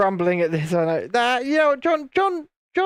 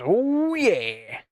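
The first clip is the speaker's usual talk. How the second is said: higher, drawn-out oh yeah; Vaughn's oh yeah; 6 semitones higher than usual. Retold or imitated. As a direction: stretch the oh like him. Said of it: "He says that a lot like that".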